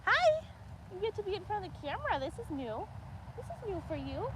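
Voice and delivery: high cooing pet talk to a cow; sweet voice for the cow on camera. Real, not acted. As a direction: coo at her, pitched well up.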